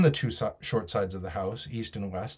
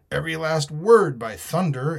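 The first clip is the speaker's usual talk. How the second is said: higher, louder pirate oath; emphatic pirate; 6 semitones higher, 6 dB louder than usual. Acted. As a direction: swear it forcefully as a sea rogue. Silver answers with oath.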